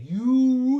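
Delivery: "you" with a long, drawn-out "oo"; overlong vowel. Shown as a demonstration. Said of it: "it's too long".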